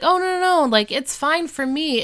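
high, quick-to-reassure imitation; brushing off sympathy. Retells Eric brushing off sympathy.

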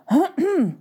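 high theatrical throat clearing. Acted. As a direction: clear your throat before the verdict. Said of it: "Ze schraapte haar keel". (keel schrapen, theatraal)

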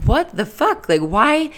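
high, disbelieving cry of what the fuck; half-laughing, half-crying exclamation at herself; baffled outburst. Retold or imitated. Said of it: "I'm like".

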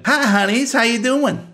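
high drawling waitress voice; waitress Wilma. Acted. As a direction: greet them like a folksy old diner waitress.

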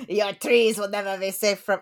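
hammed-up French accent; mock French villain. Mustached beetle threatening the trees, mock French villain voice. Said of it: "I don't know why he's also French".

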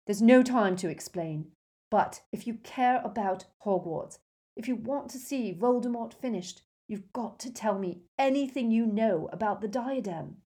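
heated, insistent demand; fierce. A narrator's reading. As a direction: press hard for an answer. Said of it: "Harry said fiercely".

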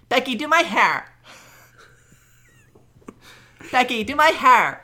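put-on high, valley-girl style woman's voice.

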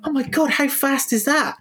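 high-pitched mock astonishment; amazed reaction retold. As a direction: marvel at the speed, pitched well up. Retells his amazed first reaction.

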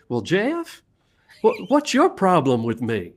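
lightly imitated Southern boss, high on Well Jeff; a hint of a Southern drawl, slow at the end; mock Southern manager asking, slow; Southern accent. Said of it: "he had a definite Southern accent".

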